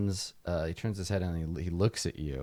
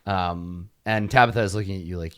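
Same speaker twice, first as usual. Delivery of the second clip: ordinary talk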